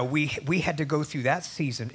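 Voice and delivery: ordinary talk, nothing marked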